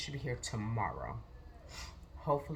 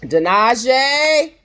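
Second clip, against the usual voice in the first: sing-song shout of the name, much higher and louder; showy high shouted sign-off; big name call; 12 semitones higher, 21 dB louder than usual. Acted. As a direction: belt the name out like an announcer. Calls out own name on camera.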